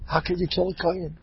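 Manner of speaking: a trembling hush of reverence; hushed awe. Acted. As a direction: say it in hushed disbelief. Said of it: "The awe".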